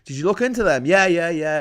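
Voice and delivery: ordinary delivery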